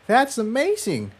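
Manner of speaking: high, soft, breathless wonder; amazed exclamation. A narrator's reading. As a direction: gasp it out in awe as the filly. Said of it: "Tiptoe exclaimed".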